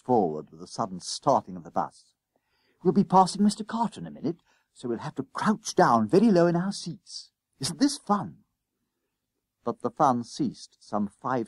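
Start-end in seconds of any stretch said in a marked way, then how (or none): 2.8-8.4 high, excited schoolboy voice; Jennings, gleefully plotting. A narrator's reading. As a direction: share the adventure in a high boyish voice.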